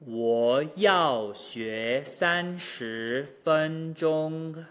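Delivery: very slow, every syllable held apart; exaggerated slow drill. Shown as a demonstration. Teacher drilling back to front at natural pitch, very slow. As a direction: stretch each tone out deliberately. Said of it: "exaggeration and slow speech".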